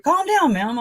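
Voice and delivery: high-pitched imitation of a cop; mock cop. Cop telling an overwhelmed driver off, mock high voice. Acted cop in retold argument.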